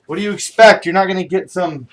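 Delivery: loud fed-up scoff; ranting. Real, not acted. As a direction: vent at the absurdity. Ranting about going gold.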